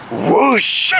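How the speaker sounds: sudden whoosh with a long hissing tail; imitated whoosh. Telling how it came out, imitated whoosh. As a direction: imitate a sudden gushing release. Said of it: "it just came out".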